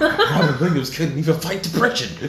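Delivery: loud, high punchline through laughter; laughing outburst. Real, not acted. Comedian to co-host with a dark joke, bursting with laughter. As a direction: blurt it out loudly, cracking up.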